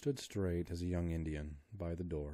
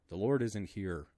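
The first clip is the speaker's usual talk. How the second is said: ordinary delivery, nothing marked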